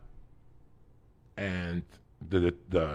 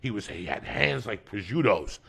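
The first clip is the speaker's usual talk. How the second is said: ordinary talk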